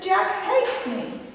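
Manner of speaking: high, slow mock whimper; imitated tearful wail. Sobbing sister answering her mother, imitated tearful wail. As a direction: whine it high, as if through sobs. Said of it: "crying got louder and became sobbing".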